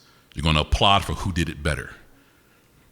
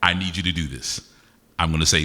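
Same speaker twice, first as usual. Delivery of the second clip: usual speaking voice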